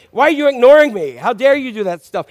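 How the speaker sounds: mock indignation. Ignored spouse confronting their partner, acted indignant and loud. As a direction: demand it like an affronted spouse.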